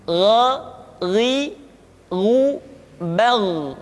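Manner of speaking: "Gha" thick, "ru" with fully rounded lips; gha ri ru bal, lips open then rounded; thick gha demo. Shown as a demonstration. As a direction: open mouth on "gha", pout only on "ru". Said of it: "keluar vokal u dengan sempurna".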